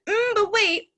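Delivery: high, loud acted hesitation; sudden second thought. Acting her sudden second thought.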